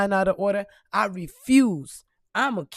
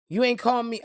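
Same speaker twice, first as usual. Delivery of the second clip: ordinary talk, nothing marked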